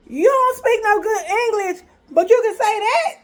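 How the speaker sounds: high, incredulous outburst; indignant disbelief. Retold or imitated. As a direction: act out being scandalized by a proposition. Outraged at his proposition.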